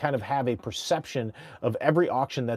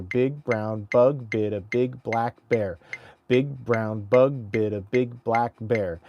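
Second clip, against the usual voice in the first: slow tongue twister; slower than usual. Shown as a demonstration. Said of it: "tongue twister clarity drill".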